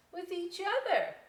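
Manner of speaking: lightly voiced dismissive bat. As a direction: turn him away lightly. Other bat adding.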